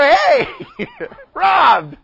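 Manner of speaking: loud, high-pitched holler; calling out. Acted. Preacher hollering at Rob after the joke, calling out. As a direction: shout it to grab someone's attention.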